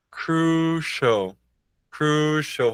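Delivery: crucial dito crúshou, sem o i; pronúncia aportuguesada. Shown as a demonstration. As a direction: diga crucial como crúshou. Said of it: "em portuguesado". (crucial aportuguesado, crúshou)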